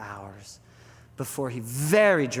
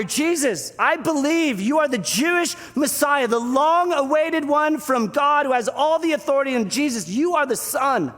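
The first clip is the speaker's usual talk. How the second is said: loud, high proclamation; bold declaration; 12 semitones higher, 6 dB louder than usual. Acted. Peter declares Jesus the Messiah, bold proclamation. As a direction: throw it out with full conviction. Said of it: "declarative statement. He just kind of throws it out there".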